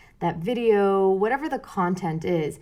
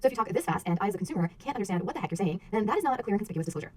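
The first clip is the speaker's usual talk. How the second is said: rapid-fire, softer disclaimer rattle; too fast to follow; 5 dB quieter, much faster than usual. Shown as a demonstration. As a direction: race through it like fine print at the end of an ad. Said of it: "they should not sound like this".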